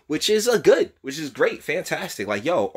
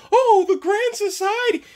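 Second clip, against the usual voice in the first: high mocking falsetto; mocking quote; 5 dB louder than usual. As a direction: sneer at her like a prissy snob. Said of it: "'Cause all this".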